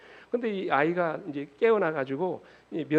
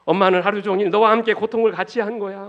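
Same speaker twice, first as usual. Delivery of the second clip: loud, higher-pitched defence of the mother; impassioned father; 5 semitones higher, 9 dB louder than usual. Acted. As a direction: press it on the child with force.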